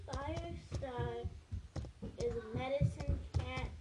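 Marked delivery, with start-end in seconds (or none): none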